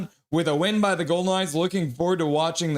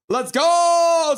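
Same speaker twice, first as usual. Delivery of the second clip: higher, louder hype shout; shouted cheer; 12 semitones higher, 7 dB louder than usual. Retold or imitated. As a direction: yell it like a fan. Reading a chat cheer.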